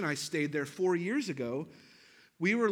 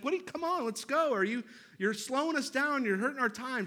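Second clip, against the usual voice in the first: much higher, pushy wife voice; imitating the wife fussing over her GPS time; impatient wife; 8 semitones higher than usual. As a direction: hurry him along, annoyed. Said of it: "She's like".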